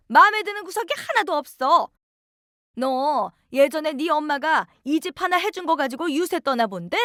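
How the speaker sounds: high, sneering scolding voice; scornful mother-in-law. Acted. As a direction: look down on her and scold with contempt. (며느리를 깔보며 꾸짖는 시어머니, 높고 비아냥대는 목소리)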